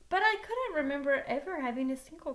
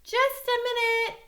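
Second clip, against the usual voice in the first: loud, high shouted call; shouting; 11 semitones higher, 8 dB louder than usual. Acted. Boy shouts back to the girl at the door. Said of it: "I shouted".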